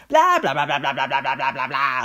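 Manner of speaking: drown the other person out; loud childish blocking-out chant; called-out blah-blah. Acted. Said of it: "rief ich, die Augen weiterhin fest zusammengekniffen".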